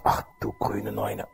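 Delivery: low, very soft and slow; slow, dismayed undertone; stunned hushed thought. Retold or imitated. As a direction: murmur it to yourself in shock. Shocked thought, slumped in corner.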